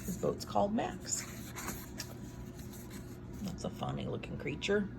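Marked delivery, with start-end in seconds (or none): none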